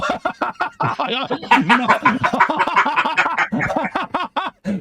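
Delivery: high, loud cackle of laughter. Real, not acted. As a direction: crack up laughing. Laughing at own teasing.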